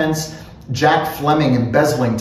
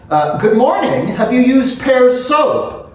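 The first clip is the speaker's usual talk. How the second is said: high-pitched character greeting; hearty greeting; 11 semitones higher, 4 dB louder than usual. A narrator's reading. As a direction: pitch it well up and stretch the question. Bloom greeting in voiced dialogue.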